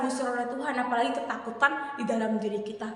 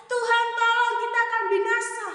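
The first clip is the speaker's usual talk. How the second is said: mock cry for help; 9 semitones higher, 4 dB louder than usual. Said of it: "mereka berteriak".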